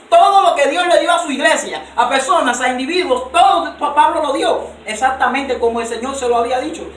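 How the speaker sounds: loud, high, driving sermon voice; fiery preaching. Acted. A preacher hammering the point home to his congregation, loud and high. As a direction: preach it out with rising fire.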